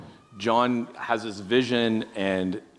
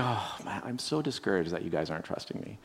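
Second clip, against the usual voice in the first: discouraged; 2 semitones lower, 8 dB quieter than usual. Pretend. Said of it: "Or would he be discouraged?".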